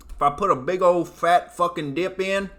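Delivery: ordinary talk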